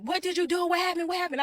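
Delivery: high, urgent questioning without real volume; mock yell of alarmed disbelief; retold yelling. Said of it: "I'm yelling at him".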